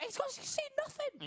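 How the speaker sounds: tiny high squeaky mouse. Pretend. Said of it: "squeaky mouse voice".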